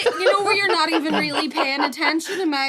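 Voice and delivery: can barely get the words out for laughing; giggling storyteller fighting laughter; laughing while telling. Real, not acted.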